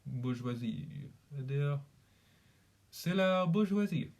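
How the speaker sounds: practising a put-on French accent. Shown as a demonstration. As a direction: savour the French vowels of "bourgeoisie". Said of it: "So French is bourgeoisie".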